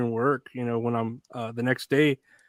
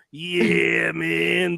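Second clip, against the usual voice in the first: lightly strained commentator catchphrase; hint of a hammy hype impression; Booker T; 2 semitones higher than usual. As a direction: oversell it in a put-on voice. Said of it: "how does he fucking do it".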